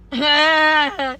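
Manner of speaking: loud embarrassed laugh; burst of laughter. Real, not acted. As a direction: break into a loud, self-conscious laugh.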